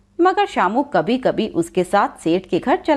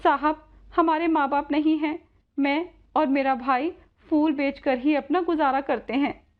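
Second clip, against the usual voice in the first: put-on higher, earnest girl's voice; sorrowful young Radha; 5 semitones higher than usual. Orphan Radha explains their hard life, higher earnest voice. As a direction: answer as a humble orphan, pitched up.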